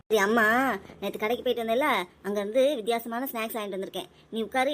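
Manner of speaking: normal talking voice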